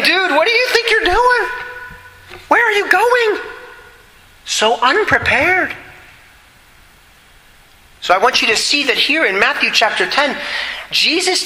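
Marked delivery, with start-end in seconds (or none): 0.0-5.7 high-pitched, disbelieving buddy in arms; incredulous comrade. Acted. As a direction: challenge him like a stunned fellow soldier, pitched way up. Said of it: "His brothers in arms would say to him".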